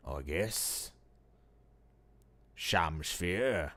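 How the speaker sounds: low, weak Cockney convict rasping; dying convict voice. Shown as a demonstration.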